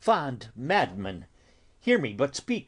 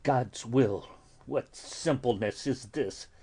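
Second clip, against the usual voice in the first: normal talking voice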